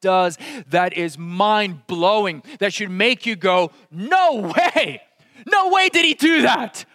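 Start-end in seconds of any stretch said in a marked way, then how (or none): 3.9-6.8 high, loud "No way!" in mock astonishment; amazed disbelief. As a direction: gasp it out like you can't believe it. Acted reader's disbelief.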